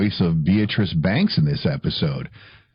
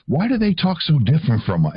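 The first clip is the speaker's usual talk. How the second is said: put-on curious child; 7 semitones higher than usual. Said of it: "They're like".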